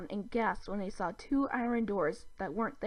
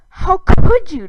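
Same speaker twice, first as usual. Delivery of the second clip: high, loud horrified cry; outraged shock at the cruelty; shocked gasp; 10 semitones higher, 22 dB louder, slower than usual. A narrator's reading. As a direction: gasp it out in disbelief. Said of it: "Dib gasped".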